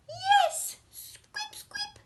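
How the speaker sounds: very high squeaking mouse; squeaky mouse. Acted. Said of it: "squeaked the ogre".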